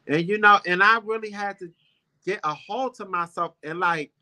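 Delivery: ordinary delivery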